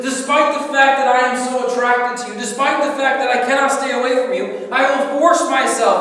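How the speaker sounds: loud, high, impassioned plea as God; God begging his people, torn but resolved; God pleading. Acted. God pleading with his people, loud and impassioned. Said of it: "He says".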